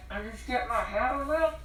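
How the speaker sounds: normal talking voice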